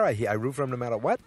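normal talking voice

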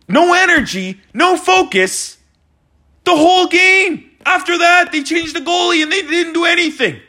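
high-pitched outraged fan rant; exasperated yelling. Real, not acted. Ranting at the team's effort.

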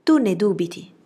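a touch of flustered emphasis; blushing exclamation. A narrator's reading. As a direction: protest at your sister's doubt. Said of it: "esclamò Jane arrossendo".

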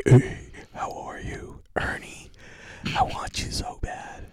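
slow, drawn-out whisper; mock-seductive whispering; creepy whisper. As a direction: whisper it slowly, like a creepy come-on. Mimicking a creepy whispered line.

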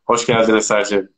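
normal talking voice

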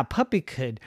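usual speaking voice

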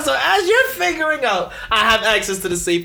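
high, loud, laughing brag; laughing boast. Acted. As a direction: crow it out through laughter. Gleeful bank robbing boast.

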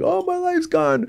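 high, slow whiny wail; mock the overdramatic new inmate, wailing high; mock despair. Mocking inmates who overreact, in a high slow wail. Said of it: "some people go to jail and they're like".

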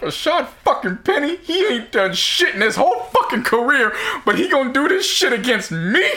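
high, exasperated imitation of friend; retold outraged friend. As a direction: squeal it out like a sore loser. Friend's pained rant retold.

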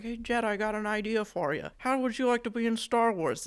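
soft, high-pitched caricature of a dad; George Lucas. Acted. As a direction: imitate a filmmaker pitching softly to his son. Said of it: "This is what George Lucas sounds like".

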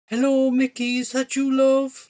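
high, drawn-out motherly greeting; high-pitched mam; mother on the phone. A narrator's reading.

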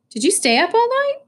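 high-pitched questioning mom, rising sharply; mom's voice lifted in surprise; surprised mom. Acted. Mom questions her son at pickup, surprised.

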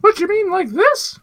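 very high cartoon gator squeak; squeaky falsetto like a cartoon mascot; Gus the Gummy voice. Showing off Gus on request, high cartoon voice. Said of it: "do your best Gus voice".

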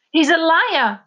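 loud high accusing cry; angry accusation. A narrator's reading. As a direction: hurl it at him. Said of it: "repeated Avalon".

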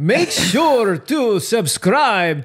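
high, loud, slow Dracula drawl, laughing; vampire voice. Acted. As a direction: ham up a Count Dracula sign-off. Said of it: "your vampire impression".

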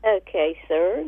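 ordinary delivery

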